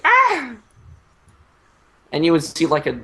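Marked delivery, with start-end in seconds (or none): 0.0-0.5 high mock scream of a fleeing soldier. Retelling a British soldier running in panic, mock high scream. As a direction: shriek like a panicked redcoat, far up in pitch. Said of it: "It was like".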